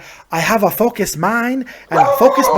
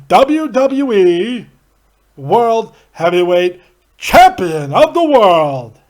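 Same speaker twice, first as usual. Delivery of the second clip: booming, very drawn-out ring announcer; Howard Finkel; 5 dB louder, much slower than usual. Acted. Host imagines a champion's entrance, as a ring announcer. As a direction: stretch each title word with big swells and pauses. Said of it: "Can you imagine Finkel?".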